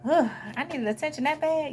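high-pitched cringing disbelief. Acted. Looking back at younger self on Facebook, cringing. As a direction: wince at your own past in mock horror. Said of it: "get cringe 'cause you're like".

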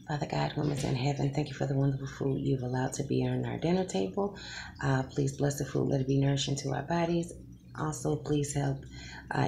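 soft, quick mealtime grace; quiet prayer. Acted. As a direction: murmur a fast blessing. Blesses the food.

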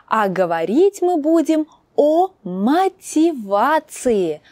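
usual speaking voice

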